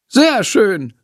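high, delighted praise; teacher happy with the rehearsal; pleased teacher. Acted. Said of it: "freut sich Frau Schmidt".

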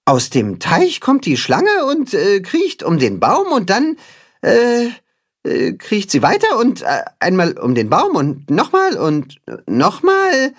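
high voice stumbling between ums; hesitant, muddled. A narrator's reading. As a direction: fumble through half-remembered steps. Timme fumbles the knot instructions.

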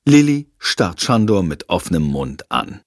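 ordinary delivery, nothing marked

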